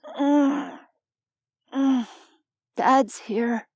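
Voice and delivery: put-on drowsy child mumbling; sleepy mumble. A narrator's reading. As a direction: mumble it half asleep. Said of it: "Jace mumbled".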